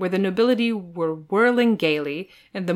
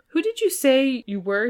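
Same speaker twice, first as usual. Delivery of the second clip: puzzled question pitched higher; mildly puzzled prince; 7 semitones higher, 4 dB louder than usual. A narrator's reading. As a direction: ask as if you can't place him. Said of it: "he asked in a puzzled tone".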